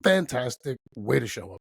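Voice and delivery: ordinary delivery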